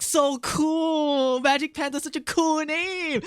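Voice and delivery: high, starry-eyed gush over a cool name; dazzled kid. Retold or imitated. Kid thrilled by his new gamer name, high excited gush. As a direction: gush like an awestruck twelve-year-old. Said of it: "my eyes were dazzling and I was like".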